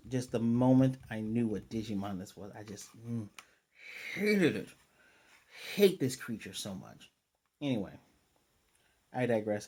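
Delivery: ordinary talk, nothing marked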